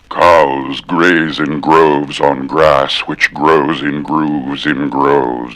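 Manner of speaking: deep slow drawling voice; slow low voice. Shown as a demonstration. Mock-deep voice for the cow twister.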